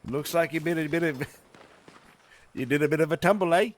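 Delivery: a jokey Kiwi twang; bantering in a put-on New Zealand accent. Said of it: "You sounded New Zealand there".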